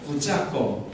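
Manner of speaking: short Gyeongsang regional accent demo; Gyeongsang dialect. Shown as a demonstration. Pastor asks for the sermon title in dialect, Gyeongsang accent. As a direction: say it like a Gyeongsang local. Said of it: "경상도 사투리".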